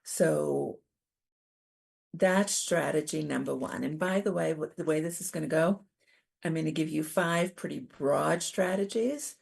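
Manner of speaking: normal talking voice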